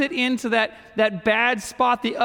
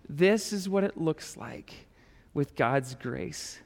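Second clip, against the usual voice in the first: put-on slow, soft voice to a child; gently telling his son about grace; tender, softer; 4 semitones lower, 7 dB quieter, slower than usual.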